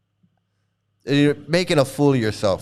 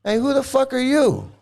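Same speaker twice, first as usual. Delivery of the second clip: sharp, higher angry challenge; 7 semitones higher than usual. Real, not acted. Streamer angrily confronting a chatter who cussed. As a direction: demand to know who they are.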